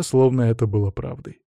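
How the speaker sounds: usual speaking voice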